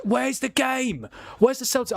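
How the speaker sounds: high, urgent, rushed questions; scrambling to find the game; real panic at a missing stream; frantic searching. Real, not acted. Hunting for the penalty stream.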